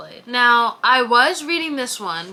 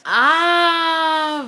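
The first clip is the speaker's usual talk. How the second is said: high, loud anguished "ah"; a mock-anguished wail at the next book; dramatic wail; 7 semitones higher, 5 dB louder than usual. Real, not acted. Vlogger groans at a book she dreads, anguished wail.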